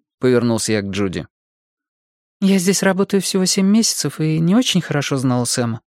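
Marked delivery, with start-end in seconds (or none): 2.4-5.8 higher-pitched voice for a woman character; lifted, lighter character voice; Judy, questioned employee. A narrator's reading. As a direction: voice a junior employee answering cautiously, pitched up.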